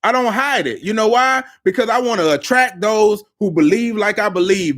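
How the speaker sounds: usual speaking voice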